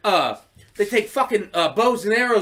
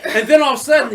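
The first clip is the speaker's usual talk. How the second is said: normal talking voice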